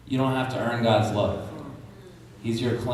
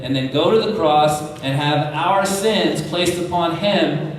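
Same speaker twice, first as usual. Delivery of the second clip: rising preaching; 4 semitones higher, 3 dB louder than usual. Acted. Preacher builds to climax.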